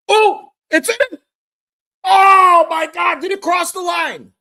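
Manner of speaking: high, loud screams of joy; screaming goal celebration. Real, not acted. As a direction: shriek it like the winning goal just went in.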